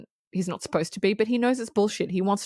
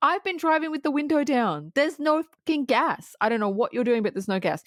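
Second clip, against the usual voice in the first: high, indignant mock outrage; incredulous anger; 7 semitones higher than usual. Roy telling the officials the gas is fake, indignant. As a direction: scoff at the officials as if they're stupid. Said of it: "he's looking at them like".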